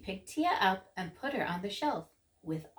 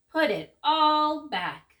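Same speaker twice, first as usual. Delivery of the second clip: slow, loud stern command; stern order; 6 semitones higher, 6 dB louder, slower than usual. A narrator's reading. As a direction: lay down the law, slowly. Father orders.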